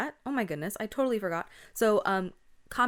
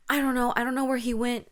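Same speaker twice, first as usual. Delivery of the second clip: loud, higher-pitched acted panic; freaking out; 6 semitones higher, 7 dB louder, faster than usual. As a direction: blurt it like a frantic friend. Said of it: "she's freaking out".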